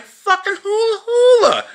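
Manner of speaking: excited shout. Pretend. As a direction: yell his name like spotting an old buddy. Greeting him at a convention.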